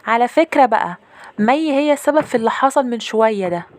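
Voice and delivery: put-on high, loud child tattling; accusing little sister. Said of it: "لقيت أختي ميرنا بتقول".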